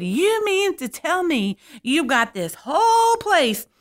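wag a finger and lecture them like a diva; diva scolding. Retold or imitated. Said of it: "she's wagging it at those people".

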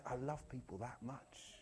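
very soft, near-whispered; tender. Acted. Preacher voicing Jesus's love for people, tender and hushed. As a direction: hush it right down, heartfelt.

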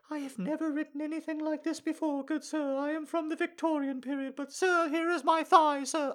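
very high falsetto Victorian woman; Victorian lady. Acted. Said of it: "I definitely like women going".

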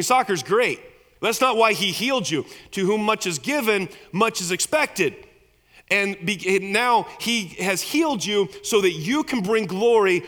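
loud, high, driving sermon voice; full-force preaching. Acted. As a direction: preach it hard to the congregation.

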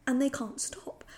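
hushed, breathless whisper of disgust. Real, not acted. Host whispering in disgust about the couple kissing. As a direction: whisper it like it's too gross to say aloud.

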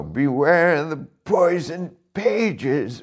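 put-on high, very slow creaky old librarian; old man voice. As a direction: warn them slowly like an ancient sage. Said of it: "Any excuse to do old man voice".

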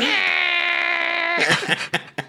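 high screeching laugh; shrieking laugh. Real, not acted. Squealing laugh after Gottfried joke.

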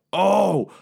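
furious queen. Acted. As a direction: explode with rage at her son's death. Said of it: "She's like".

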